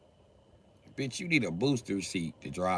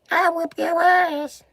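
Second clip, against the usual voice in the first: much higher, slow squeaky mock threat; mock tough short woman; 7 dB louder, slower than usual. Mocking short women acting tough, squeaky threat. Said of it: "really think they tough".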